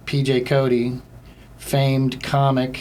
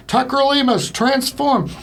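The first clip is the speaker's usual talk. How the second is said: very high, put-on robot command; Transformer robot; 12 semitones higher, 3 dB louder, slower than usual. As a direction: announce it like a transforming cartoon robot. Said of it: "that sounds like a transformer".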